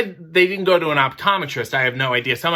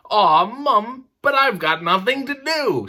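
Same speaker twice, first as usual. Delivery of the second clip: put-on higher, pleading child's complaint; whiny kid; 7 semitones higher than usual. Charlie begs his mum, whiny child.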